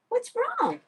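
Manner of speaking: put-on squeaky high baby talk to the guinea pig; high pet voice. Owner to her guinea pig, high squeaky baby talk.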